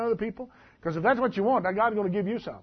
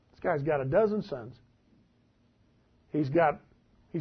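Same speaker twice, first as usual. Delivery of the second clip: ordinary delivery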